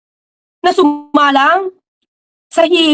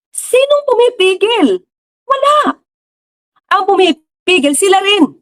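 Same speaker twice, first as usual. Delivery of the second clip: rhetorical challenge; 6 semitones higher, slower than usual. Real, not acted.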